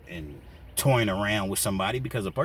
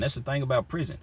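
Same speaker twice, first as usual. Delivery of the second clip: ordinary talk, nothing marked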